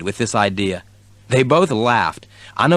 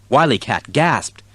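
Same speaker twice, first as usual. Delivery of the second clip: plain reading voice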